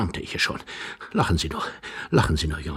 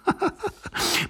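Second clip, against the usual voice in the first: squeaky high stifled giggle; 5 dB quieter than usual. Acted. Young man mocking the old man, stifled giggle. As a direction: giggle trying to stay hidden.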